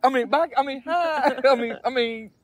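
high-pitched cooing, tickled; dog-greeting voice. Real, not acted. Fumbling hi and bye to a dog, high giggly coo. Fussing over a cute dog.

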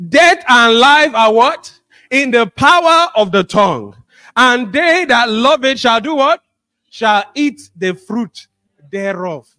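booming preacher reading scripture; fiery preacher sermon. Acted. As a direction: proclaim each phrase to the congregation. Preacher reading scripture with call-outs.

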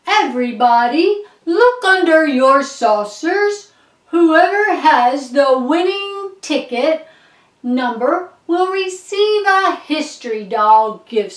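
loud, high public announcement; lady announcer. A narrator's reading. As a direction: address the whole room, projecting clearly. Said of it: "a lady announces the raffle".

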